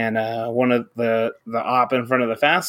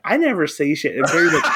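normal talking voice

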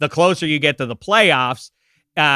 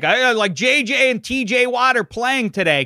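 mock quote; 9 semitones higher than usual.

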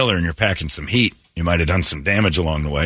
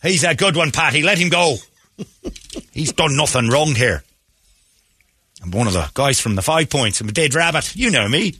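jovial Irish cop, pitched up; hearty Irish brogue offering a pint; Irish accent; 6 semitones higher than usual. Pretend. Irish cop vouching for the cuffed man, Irish accent. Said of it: "your Irish accent".

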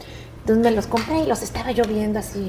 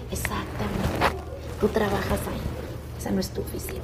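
soft mother's correction; 2 semitones lower, 10 dB quieter than usual. Retold or imitated. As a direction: quietly set her straight.

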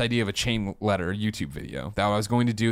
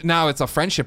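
ordinary talk, nothing marked

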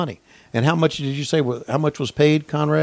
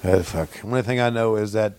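lightly voiced Heenan; 6 semitones lower than usual. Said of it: "Heenan said".

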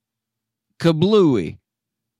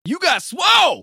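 shout; 12 semitones higher than usual. Real, not acted. Shouted teasing.

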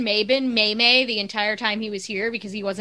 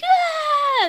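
high, drawn-out fan cheer; high mock cheer, held long; mock crowd cheer; 4 dB louder than usual. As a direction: imitate an excited crowd's long "ah". Imitates fans cheering double play.

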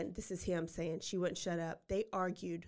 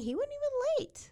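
high, mocking sarcasm; sarcastic; 4 dB louder than usual. Real, not acted. Host mocks the killer's work ethic, high sarcastic. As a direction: jab at him with mock praise.

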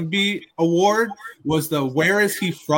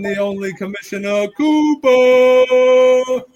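hype announcer; 5 semitones higher, 4 dB louder than usual. Acted. Hype intro of a guest.

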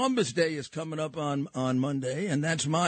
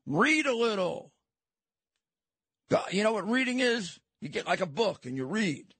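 higher-pitched, mocking lecture; condescending; 5 semitones higher than usual. Real, not acted. Host schooling statue topplers on reading, condescending. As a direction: explain it as if to a dunce. Mocking opponents as non-readers.

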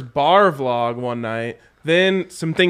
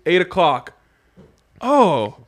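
usual speaking voice

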